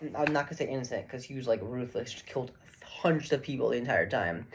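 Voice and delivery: usual speaking voice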